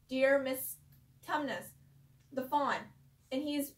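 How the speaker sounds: ordinary delivery, nothing marked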